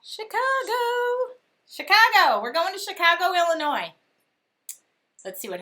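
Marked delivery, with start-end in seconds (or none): 0.0-3.9 excited cry. Real, not acted. Host cheering the trip city to her viewers, excited and high. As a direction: cheer the city name like great news.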